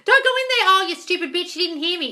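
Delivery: high, loud mock yell at the screen; hammed-up shriek at a film character; yelling at a movie. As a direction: scream at a horror heroine who won't listen. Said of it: "yelling at a movie on the screen".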